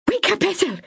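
urgent, high call to wake her; panicked cry. Acted. Myra waking Ethel in the cabin, panicked high cry. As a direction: shake her awake with your voice. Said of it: "Myra cried".

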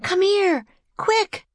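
put-on very high, girlish summons; urgent shout. A narrator's reading. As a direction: call him over urgently, voice way up. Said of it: "Annie shouted".

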